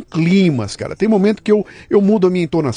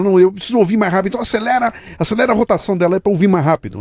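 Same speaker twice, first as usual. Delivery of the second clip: tag impaciente; 7 semitones higher, faster than usual. Acted. Acted impatient listener wanting speed.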